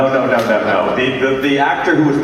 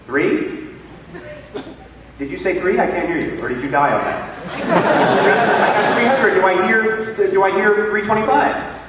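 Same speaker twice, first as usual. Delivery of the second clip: higher, lively auction patter; auctioneer calling bids; 8 semitones higher than usual. Acted. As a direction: run the room like a charity auctioneer.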